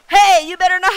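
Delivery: very loud, high mock-mom scolding. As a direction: scold at full volume, imitating a mom. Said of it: "sorry, I'm so loud".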